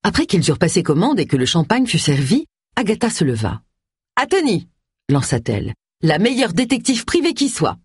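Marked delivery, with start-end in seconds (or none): 4.1-4.7 high-pitched, lifted toast; proposing a toast. A narrator's reading. Agatha stands to toast Tony, proclaiming it. 6.0-7.8 high-pitched, lifted toast; proposing a toast. A narrator's reading. Agatha stands to toast Tony, proclaiming it.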